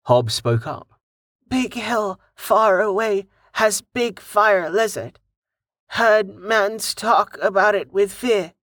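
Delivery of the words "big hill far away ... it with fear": higher, slow broken goblin speech; eager little goblin, simple words. A narrator's reading. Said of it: "he added, happy to contribute".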